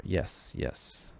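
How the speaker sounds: whispered "yes, yes", then "I know" back in normal voice; resigned whisper. Shown as a demonstration. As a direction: breathe the yeses like a guilty aside. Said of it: "We'll put that in there".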